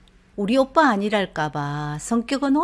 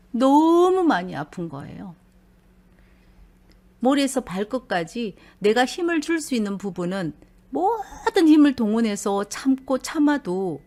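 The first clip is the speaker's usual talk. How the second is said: usual speaking voice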